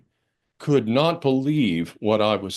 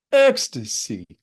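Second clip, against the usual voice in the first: drawn-out ecstasy; slower than usual. Shown as a demonstration. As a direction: open up and relish ecstasy. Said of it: "feeling of ecstasy".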